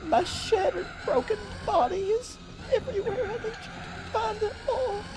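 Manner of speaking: very high, breathy-free falsetto plea; fairy voice. Pretend. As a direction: squeak it out like a tiny fairy. Acting out a broken stray fairy.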